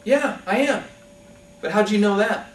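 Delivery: pleased surprise. Acted. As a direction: sound delighted he was guessed. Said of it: "The guy on the ground says".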